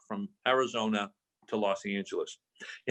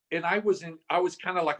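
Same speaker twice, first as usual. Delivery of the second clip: ordinary delivery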